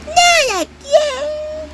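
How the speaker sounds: high, slow crying wail. Acted. Yellow Toad wailing at being up for elimination again. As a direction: wail it out, heartbroken.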